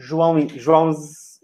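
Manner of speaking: "João" then "João's", slowly merging IS into a final s; showing the contraction. Shown as a demonstration. Teacher showing beginners the contraction, slowly. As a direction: slowly demonstrate how "João is" shrinks to "João's". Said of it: "Show me the contraction".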